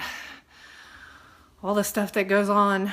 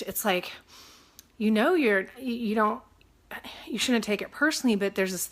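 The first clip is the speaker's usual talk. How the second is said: normal talking voice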